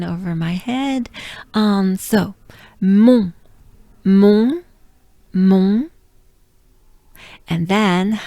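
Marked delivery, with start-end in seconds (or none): 2.8-5.9 mon said loud with a nasal on, no T; nasal French on. Shown as a demonstration. Teacher drilling the nasal vowel loudly. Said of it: "that funny sound, on, on".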